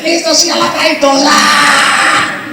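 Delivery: high-pitched mock army charge yell; rally the troops, then a long held yell; imitated battle cry. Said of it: "cómo gritan?".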